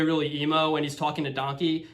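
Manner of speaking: normal talking voice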